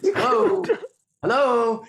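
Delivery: high, loud sing-song hello; calling out for the breakdown like at a door; mock calling out. Calling out for a breakdown, high and loud.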